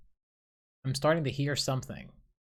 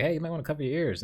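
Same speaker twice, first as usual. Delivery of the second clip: quick casual heads-up, pitched up; casual warning; 6 semitones higher, 4 dB louder, much faster than usual. Retold or imitated. Dreamer repeating a warning to bystanders, casually. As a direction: toss it off fast, like no big deal. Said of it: "Saying this casually".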